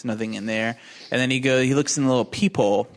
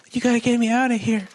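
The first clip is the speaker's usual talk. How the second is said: put-on a much higher, quick pleading girl's voice; panicked girl; 11 semitones higher, faster than usual. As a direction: beg fast in a high frightened voice. Trapped girl begs for rescue.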